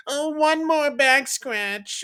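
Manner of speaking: put-on high, slow pleading child; whiny kid voice. Dad imitating his kid begging for a back scratch, high and drawn out. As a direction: beg like a sleepy kid stalling bedtime.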